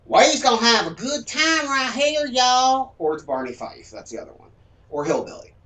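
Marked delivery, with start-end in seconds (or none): 0.1-2.9 loud, high hammed-up Southern drawl; exaggerated good-ol'-boy Southern voice. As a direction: caricature a hillbilly below the Mason-Dixon. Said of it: "Below it".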